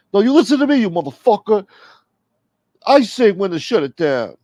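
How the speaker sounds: loud, high-pitched mock tirade; acted angry yelling. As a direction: yell it like you're laying down the law. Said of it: "yell at Smoke".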